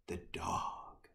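secretive whisper for the next story; whispered teaser. Real, not acted. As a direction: breathe it out like a secret.

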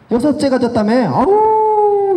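high, drawn-out mocking "auu"; nosy friend's exaggerated dismay; belittling groan. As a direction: groan it long and high, full of disdain. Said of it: "비하하고 싶은 거지".

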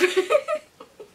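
high, loud peal of real laughter; helpless laugh. Real, not acted. Prankster after sister's "just sleep" text, helpless laugh. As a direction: burst out laughing.

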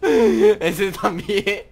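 high loud laugh, slow words. Real, not acted. Watching a kill.

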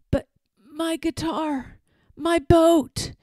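moaning. Acted. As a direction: lament the lost boat. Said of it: "moaned the Jollyman".

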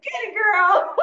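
high, loud cheer for her daughter. Retold or imitated. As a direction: shout her on, thrilled and laughing.

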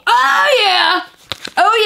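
high delighted oh yeah; excited squeal. Real, not acted. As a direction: squeal with glee.

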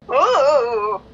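ordinary delivery

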